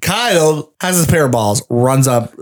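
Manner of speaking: ordinary talk, nothing marked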